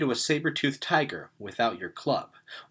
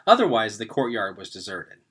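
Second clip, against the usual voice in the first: usual speaking voice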